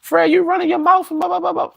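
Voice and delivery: very high falsetto imitation of nagging; mock scolding. Man imitates woman scolding her lover, mock falsetto. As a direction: rattle off a complaint in falsetto.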